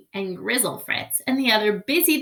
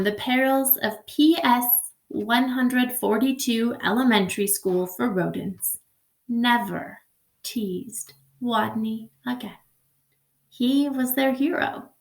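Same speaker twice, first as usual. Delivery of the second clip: ordinary delivery, nothing marked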